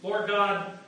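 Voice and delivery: normal talking voice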